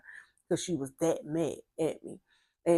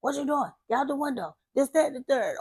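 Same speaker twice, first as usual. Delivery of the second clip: mild retold scolding, pitched up; mock yell; 6 semitones higher than usual. Adult catching kids at the window, mild mock yell. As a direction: imitate an adult ordering a child off, no real shouting. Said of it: "somebody yelling at you".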